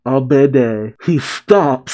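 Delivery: normal talking voice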